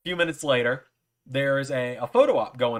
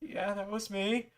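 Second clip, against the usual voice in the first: high, soft, slow reply; starstruck; 8 semitones higher, 5 dB quieter, slower than usual. Retold or imitated. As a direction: answer shyly, awed by the idol. Fan meets his idol, retold.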